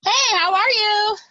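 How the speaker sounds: high, bubbly co-host greeting; chipper podcast voice. Acted. Guest greets the host on a podcast, chipper podcast voice. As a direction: brighten the room with a high-pitched hello. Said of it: "that's my podcast voice".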